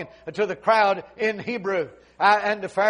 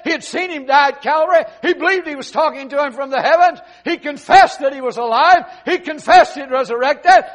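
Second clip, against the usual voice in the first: loud, high, shouted sermon climax; full-force preaching; 7 semitones higher, 10 dB louder than usual. Acted. Preacher roaring the conversion story to his congregation, loud and high. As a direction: preach it out at the top of the lungs.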